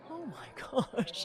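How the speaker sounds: high laughing oh my gosh; shocked gasp. Retold or imitated. Commentator reacting to a shock result, high and laughing. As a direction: let the shock break into a laugh. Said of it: "one of those shocking ones where you go".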